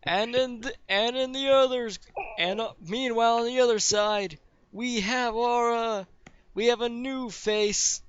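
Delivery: arena announcer. Acted. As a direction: introduce the fighters to a roaring crowd. Game master plays arena announcer.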